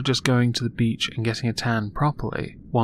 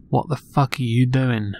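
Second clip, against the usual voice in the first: imagined coworker reaction. Retold or imitated. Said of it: "the response to you will be".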